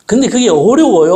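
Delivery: normal talking voice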